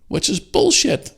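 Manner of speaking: shrill indignant yelp; indignant outburst. Real, not acted. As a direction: spit it out in disbelief. Ranting, high outburst.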